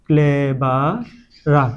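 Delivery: ক্লেভারা drawn out slowly, the added ending set apart; syllable by syllable. Shown as a demonstration. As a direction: sound out ক্লেভারা slowly in pieces. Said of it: "ইআর যোগ করলাম". (ক্লেভারা ধীরে ভেঙে ভেঙে বলা)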